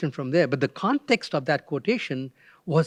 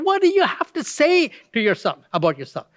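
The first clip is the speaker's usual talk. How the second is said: exploded; 8 semitones higher than usual. Retold or imitated. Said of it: "they exploded".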